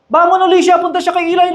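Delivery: ordinary talk, nothing marked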